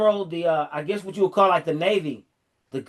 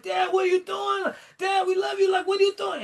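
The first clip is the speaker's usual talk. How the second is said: screaming, crying, hollering; 12 semitones higher than usual. Retold or imitated. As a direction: imitate sons hollering desperately after their father. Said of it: "they were screaming, crying, hollering".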